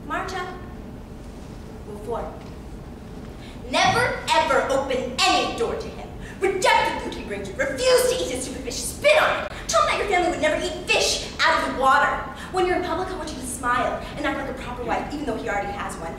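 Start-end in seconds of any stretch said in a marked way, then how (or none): 3.7-12.1 raised, high-pitched emphatic orders; fierce defiance. Acted. Girl telling another to refuse the captor's food, fiercely defiant. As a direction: spit out each instruction with disgust.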